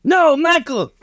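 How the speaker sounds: high-pitched cry of protest; alarmed cowboy father. Acted. Cowboy father sees Michael pushed into the pit, high alarmed cry. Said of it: "Cowboy's like".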